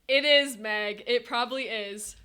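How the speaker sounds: normal talking voice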